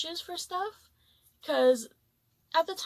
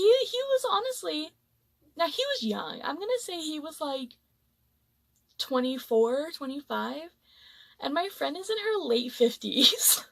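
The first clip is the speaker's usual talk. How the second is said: ordinary talk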